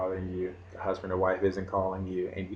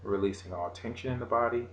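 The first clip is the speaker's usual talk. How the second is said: guided meditation; 4 dB quieter than usual. Acted. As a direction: lead the breathing calmly.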